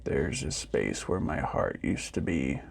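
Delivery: deep, hushed, gentle robot. A narrator's reading. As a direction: offer it kindly, like a big old machine. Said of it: "the robot said gently".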